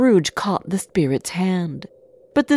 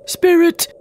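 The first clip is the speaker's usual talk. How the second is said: high, loud appeal; desperate cry; 11 semitones higher, 6 dB louder than usual. A narrator's reading. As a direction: cry out to the spirit. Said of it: "Scrooge cried".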